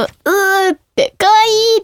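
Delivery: high mock squeal of adoration; cuteness overload. Gushing over a baby's cuteness, high-pitched adoration.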